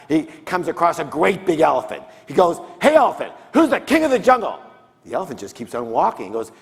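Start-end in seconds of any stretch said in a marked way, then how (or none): 2.8-4.6 high, loud challenge from a lion; boastful lion. Acted. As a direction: call out like the cocky king of beasts. Lion challenging animals in joke.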